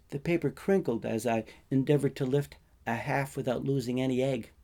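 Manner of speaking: plain reading voice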